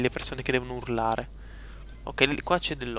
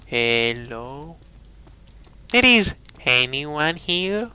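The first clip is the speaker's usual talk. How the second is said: calling out; 4 dB louder, much slower than usual. Pretend. Calling into empty game room.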